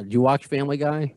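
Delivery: ordinary talk, nothing marked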